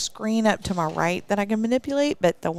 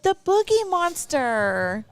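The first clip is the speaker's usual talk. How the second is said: higher, slow punchline reveal; 9 semitones higher, 4 dB louder, slower than usual. Acted. She springs the Halloween joke answer on her cohost, higher and drawn out. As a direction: land the joke with a big grin.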